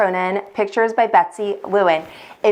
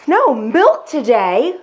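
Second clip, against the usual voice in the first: cried in dismay; 3 semitones higher, slower than usual. Acted. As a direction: cry it out in disbelief. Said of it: "cried Farmer Brown".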